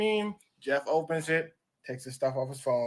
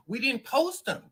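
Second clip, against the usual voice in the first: raised, louder protest; mock protest; 7 semitones higher, 8 dB louder than usual. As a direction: push back, higher and louder. Tabloid argues back at Jeff.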